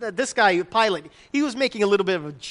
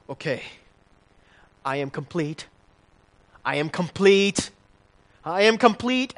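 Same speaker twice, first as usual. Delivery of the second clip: half-hearted self-talk into the mirror, building each time; mock self-persuasion; 5 semitones lower than usual. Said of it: "look in the mirror and say".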